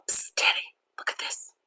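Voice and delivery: whispered to a friend; classroom whisper. Acted. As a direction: whisper so the teacher won't hear. Passing cartoon in class.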